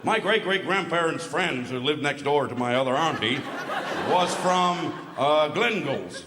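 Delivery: mocking an American tourist's accent. American boasting of distant Scottish links, mock American accent. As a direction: caricature a rambling American explaining ancestry. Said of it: "full of Yanks".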